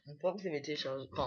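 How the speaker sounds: talking with a mouth full of food; fast, through a mouthful. Real, not acted. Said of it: "On parle pas la bouche pleine".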